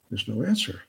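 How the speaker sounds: ordinary delivery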